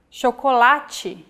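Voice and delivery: chocolate with sh at the start, Portuguese style. Shown as a demonstration. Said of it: "we have the sound".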